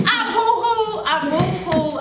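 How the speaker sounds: high wail easing down; mock boo-hooing. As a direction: act out a weepy retelling. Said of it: "I boo-hooed".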